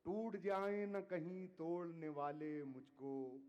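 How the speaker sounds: measured, declaiming poetry to audience; ghazal recitation. Acted. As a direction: recite each couplet with weight. Poet recites couplets.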